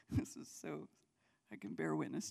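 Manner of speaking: soft breathy laugh through words. Real, not acted. Preacher laughing at Gideon's reply to God, softly. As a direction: laugh as you speak, quietly. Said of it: "that's why I'm laughing".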